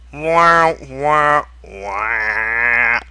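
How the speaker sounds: loud sung wah wah wah, falling away; mock trumpet wahs. Reading the fanfic aloud, he voices Kiri's dreaded trumpet with mock wahs. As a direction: blare it like a sad trombone.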